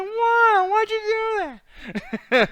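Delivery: high, loud put-on voice mimicking her; mock whine. Teasing cohost about doing nothing, high mocking voice. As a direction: squeal it in exaggerated mimicry.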